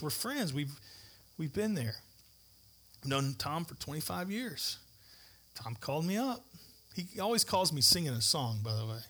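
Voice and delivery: usual speaking voice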